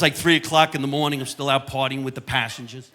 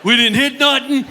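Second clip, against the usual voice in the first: comedian as captain; 8 semitones higher than usual. Pretend. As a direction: announce it like a tipsy captain on the PA. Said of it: "if I came over the loudspeaker".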